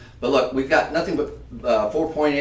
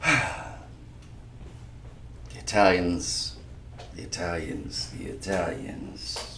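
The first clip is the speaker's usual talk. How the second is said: soft, low New York accent; 5 semitones lower, 8 dB quieter, much slower than usual. Real, not acted. Host savoring the name of the Italians, New York accent. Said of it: "did it with a New York accent".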